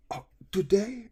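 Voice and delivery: high-pitched imitated astonishment; excited surprise. Professor learning of student's birthday, imitated excited surprise. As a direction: gasp it as a thrilled question. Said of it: "He was just super excited about it".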